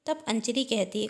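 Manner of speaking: ordinary delivery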